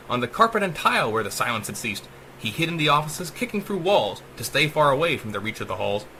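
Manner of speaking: ordinary delivery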